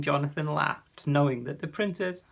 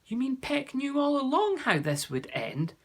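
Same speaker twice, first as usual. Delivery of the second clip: pitched high in amazement; a boy suddenly realising the bird knew; astonished; 10 semitones higher than usual. A narrator's reading.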